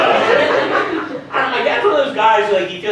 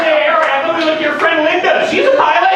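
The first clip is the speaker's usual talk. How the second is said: mock call-out; 10 semitones higher, 4 dB louder than usual. Mocking shout at Earhart.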